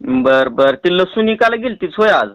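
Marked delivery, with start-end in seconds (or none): none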